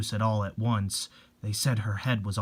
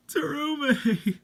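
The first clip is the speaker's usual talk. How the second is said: crying out; 14 semitones higher, much slower than usual. Acted. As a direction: sob her name, high and drawn out. Fan crying her name.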